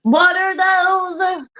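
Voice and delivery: high, slow startled shout. Real, not acted. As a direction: yell like something scared you. Startled by own face on screen.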